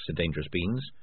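plain reading voice

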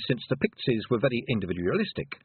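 ordinary delivery

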